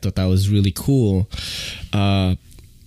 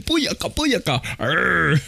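laughing, higher mock "Booyaka, booyaka"; lightly done patois chant; imitating patois shout; 10 semitones higher than usual. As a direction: echo the clip's "Booyaka, booyaka" in a giggly imitation. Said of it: "He said".

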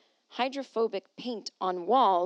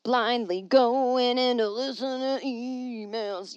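slow dramatic; 3 semitones higher, 5 dB louder, much slower than usual. Real, not acted.

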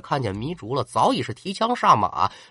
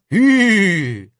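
rider's whoa call; 4 semitones higher, 5 dB louder than usual. Acted. Reining in the horse.

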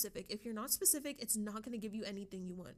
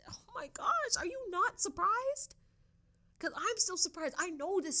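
high-pitched, louder gush of amazement; excited surprise; 9 semitones higher, 4 dB louder than usual. Real, not acted. Vlogger thrilled at what ChatGPT can do, high excited squeal. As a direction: squeal out real surprise at the chatbot. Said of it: "I'm getting excited".

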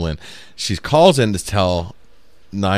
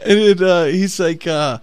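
ordinary talk, nothing marked

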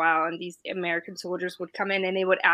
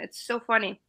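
ordinary delivery

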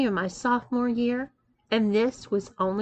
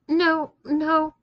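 fast, higher, frightened stammer; fearful stammer; 6 semitones higher, 3 dB louder than usual. A narrator's reading. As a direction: blurt the denial out in a rush. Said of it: "I stammered fearfully".

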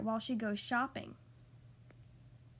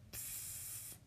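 very soft drawn-out hiss; faint unvoiced psst; baby's psst noise; 18 dB quieter than usual. A narrator's reading. Baby lands on the pavement, soft psst. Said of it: "It said".

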